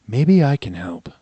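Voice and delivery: slow, calm creature voice; a strange animal speaking slowly; bear cat voice. Acted.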